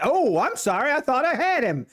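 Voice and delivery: high-pitched, put-on apologetic excuse; mock apology. Acting out the others' apology.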